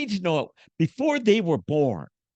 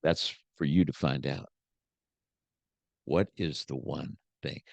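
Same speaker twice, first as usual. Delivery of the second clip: the grizzled cowboy answering deep and softer; Jack Palance cowboy; 12 semitones lower, 4 dB quieter than usual. Retold or imitated. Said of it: "And he said".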